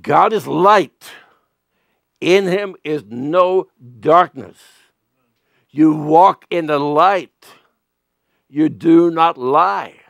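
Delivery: slow, weighty declaration; slow solemn. Real, not acted. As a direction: lay each word down slowly. Solemn scripture statement.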